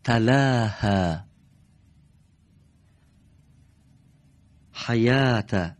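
تلاها and حیات read very slowly, each syllable drawn out; slow model reading. Shown as a demonstration. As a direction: spell out تلاها, then حیات, slowly for the class. (خواندن آهسته و شمرده)

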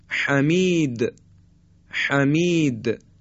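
حمید drawn out slowly, with a rough throaty ح; husky ha, slow. Shown as a demonstration. Teacher modelling the ح slowly. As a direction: stretch حمید and rasp the ح.

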